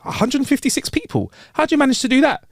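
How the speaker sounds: high incredulous question; astonished judge. Acted. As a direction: play the stunned judge. Judge voiced amazed.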